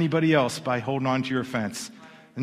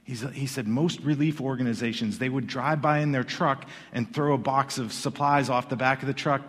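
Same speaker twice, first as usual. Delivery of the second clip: ordinary delivery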